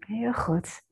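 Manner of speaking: hushed soft whisper. Acted. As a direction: whisper the praise softly. Whispered praise in meditation.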